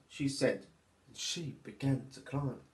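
put-on lively, sing-song reading for little children; storybook narration. A narrator's reading. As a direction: read it like storytime for toddlers.

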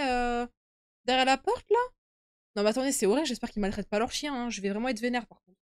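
usual speaking voice

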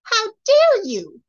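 fairy outraged at the king; indignant cry. A narrator's reading. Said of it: "cried the fairy in the bonnet".

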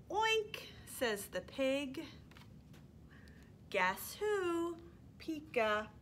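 lively, sing-song storytime reading; animated picture-book read-aloud; reading to toddlers. Acted. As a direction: read it like you're holding the book up for little ones.

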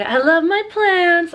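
high, slow and loud fond gushing. Real, not acted. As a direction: swoon over your plants. Gushing about plants.

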